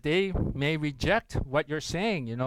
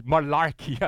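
Malarkey spat out in scorn; scornful quote; 4 dB louder than usual. Retold or imitated. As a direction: repeat the word as a mocking put-down. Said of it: "Someone actually said that to you".

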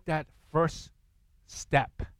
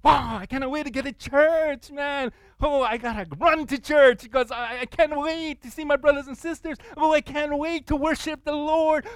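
much higher, giddy gushing; hammed-up excitement, much higher and louder; eager churchgoer; 10 semitones higher, 4 dB louder than usual. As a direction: act thrilled to run to church.

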